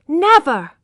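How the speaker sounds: loud, high, defiant "Never!"; loud, high defiant refusal; defiant cry. A narrator's reading. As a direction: fling the refusal back at him. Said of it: "said Tess independently".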